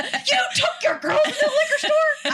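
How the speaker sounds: high, laughing mock outrage; high, laughing mom in disbelief; shocked mom. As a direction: squawk it like a scandalised mother. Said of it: "mom quoted".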